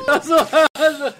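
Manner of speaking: laughing out loud, slow. Real, not acted. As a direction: burst out laughing at the joke. Joking about kissing Tom.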